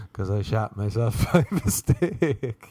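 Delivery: slow, breaking up with real laughter; laughing. Real, not acted. Friend confesses his accident from the taxi, laughing. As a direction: crack up while getting the line out.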